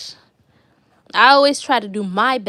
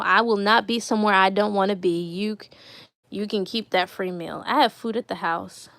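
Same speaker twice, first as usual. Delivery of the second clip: ordinary talk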